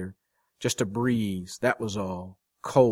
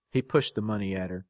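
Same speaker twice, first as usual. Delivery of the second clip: usual speaking voice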